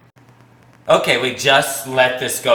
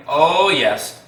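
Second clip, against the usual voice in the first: normal talking voice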